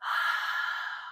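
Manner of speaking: open-mouth breathy exhale, fogging a window; Ujjayi breath. Shown as a demonstration. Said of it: "Open the mouth, fog the window".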